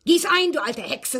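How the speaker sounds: put-on a touch of John barking at the old maid; John's angry order. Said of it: "sagte er".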